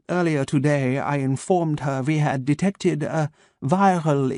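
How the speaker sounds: measured German doctor, each word placed; a calm German voice waking his captive; German accent. Acted. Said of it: "his German accent crisp and sharp".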